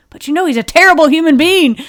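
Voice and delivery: loud, high mock outburst; imitating an indignant protest, nearly shouted; Nathan. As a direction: blurt it out loud and exaggerated. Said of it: "Nathan was always like".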